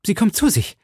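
high, breathless relief; high-pitched exclamation of hope; excited cry. A narrator's reading. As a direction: call it out high, overjoyed. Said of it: "rief der junge Mann".

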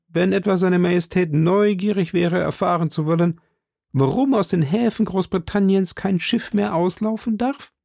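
high, hesitant servant voice; a nervous clerk questioning his master; timid secretary. A narrator's reading. Secretary asks the duke what to tell the chancellor, hesitant.

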